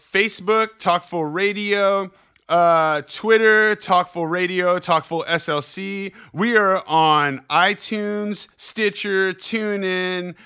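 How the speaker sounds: loud, drawn-out plug of the show's channels; promo plug. Real, not acted. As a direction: sell the podcast like an announcer. Plugging the show's social accounts.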